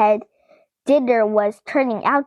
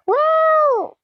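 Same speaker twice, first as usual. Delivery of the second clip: surprised cry; 14 semitones higher than usual. Real, not acted. Reader reacts with a cry.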